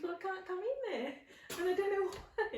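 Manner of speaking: high mum voice, laughing through it; laughing imitation of mum's odd reply; mum answering. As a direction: play mum helplessly refusing, giggling. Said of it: "And she goes".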